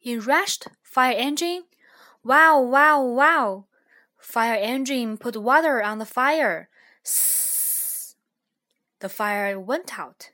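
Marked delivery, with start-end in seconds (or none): 2.2-3.7 loud siren wail done by voice; imitated siren. Fire Engine rushes in to the fire, wailing like a siren. 7.0-8.2 long soft hissing sound; water hiss. Acted. Fire Engine puts water on the fire, a long hiss.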